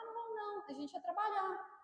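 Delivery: soft weary turning down of friends; quiet refusal. Retold or imitated. Couple declining a night out to keep working, softly. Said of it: "a gente falava".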